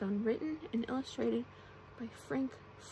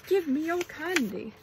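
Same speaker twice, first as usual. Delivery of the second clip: loud, higher mock yell; character yell; 5 semitones higher, 8 dB louder than usual. A narrator's reading. SpongeBob demanding candy from the party guests, yelling. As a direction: call out a playful ghostly demand. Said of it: "yelled SpongeBob".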